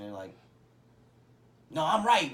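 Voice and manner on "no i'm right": louder, higher, indignant pushback; loud self-justifying retort; insistent protest. Acted. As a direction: act out digging your heels in. Acted friend insisting in an argument.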